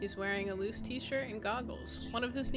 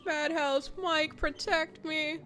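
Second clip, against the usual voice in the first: mock scared plea; 7 semitones higher, 4 dB louder, slower than usual. Real, not acted. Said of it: "everything's kinda getting creepy".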